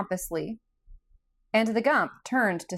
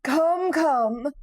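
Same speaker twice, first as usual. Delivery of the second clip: higher, kindly straw-man voice; kindly Scarecrow; 7 semitones higher, 4 dB louder than usual. A narrator's reading. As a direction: coax him gently as the kind Scarecrow. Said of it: "said the Scarecrow kindly".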